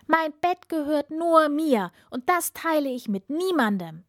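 high-pitched, emphatic refusal; indignant princess. A narrator's reading. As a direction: protest as an outraged princess. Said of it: "rief die Prinzessin". (empörte Prinzessin, hohe Stimme)

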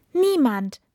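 high, loud one-word answer; hasty denial. A narrator's reading. Princess hiding the frog from her father, hasty denial. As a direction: blurt it out to cover up. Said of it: "sagte die Prinzessin schnell".

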